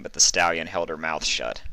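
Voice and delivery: ordinary delivery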